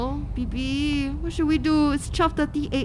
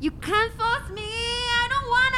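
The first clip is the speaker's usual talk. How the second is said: whiny protest; 10 semitones higher, slower than usual. Acted. Dragged out, protesting.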